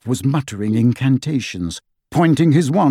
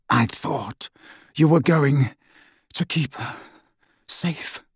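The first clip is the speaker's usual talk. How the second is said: halting, fading off in grief; broken grief; 2 semitones higher, 3 dB quieter than usual. A narrator's reading. Snape to Dumbledore after her death, grief-stricken. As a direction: choke it out in fragments, trailing away. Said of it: "Snape's breathing was shallow".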